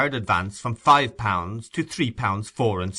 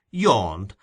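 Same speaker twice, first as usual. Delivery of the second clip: ordinary delivery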